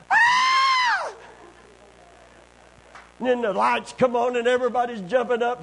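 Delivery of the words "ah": sudden high shrill scream of fright; terrified short scream; girl's scream. Retold or imitated. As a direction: shriek like a startled girl in the dark. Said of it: "some gal over here goes".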